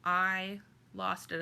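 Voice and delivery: ordinary talk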